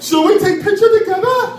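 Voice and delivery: high-pitched mock German accent; German tourist accent. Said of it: "That's how they talk".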